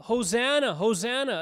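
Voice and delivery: loud, high Hosanna cry; crowd shouting. Retold or imitated. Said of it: "they're shouting".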